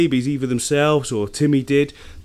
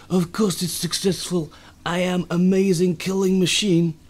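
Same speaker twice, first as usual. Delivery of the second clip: slow, higher mock Russian accent; attempted Russian accent; 5 semitones higher than usual. Turtle owner voicing a killing machine, mock Russian accent. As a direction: boast in a hammed-up Russian accent. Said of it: "an attempt at a Russian accent".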